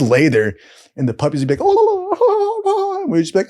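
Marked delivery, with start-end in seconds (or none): 1.6-3.0 high-pitched imitated puppy whimpers; puppy noises. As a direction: coo like a pile of puppies. Imitating puppies in the story.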